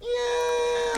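very high falsetto yeah. Shown as a demonstration. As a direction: squeak it way up. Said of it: "There's that high voice again".

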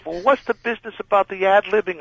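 high, panicked rant; a boss in full alarm over his star walking off; high-pitched frantic scolding. Retold or imitated. Boss questioning him.

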